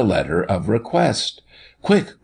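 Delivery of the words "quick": sharp high-pitched urgent call; urgent cry. A narrator's reading. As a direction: cry it out to hurry them. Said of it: "cried the doctor".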